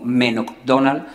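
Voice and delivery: McDonald said as "Menokdonal"; local dialect pronunciation. Shown as a demonstration. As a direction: give McDonald its Cádiz folk form, "Menok-donal". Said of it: "ese modo de hablar particular".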